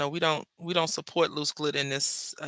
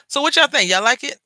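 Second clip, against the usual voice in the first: excited; 7 semitones higher, 9 dB louder than usual. Real, not acted. Asking viewers excitedly.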